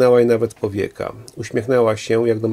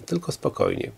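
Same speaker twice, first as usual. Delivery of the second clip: much lower and softer, almost under her breath; quiet self-calming; 12 semitones lower, 9 dB quieter than usual. A narrator's reading. Woman calming herself before the quarrel, very quietly. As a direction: drop right down and soothe yourself. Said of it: "nakazała sobie po chwili".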